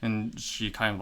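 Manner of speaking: ordinary delivery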